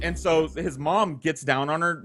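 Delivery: normal talking voice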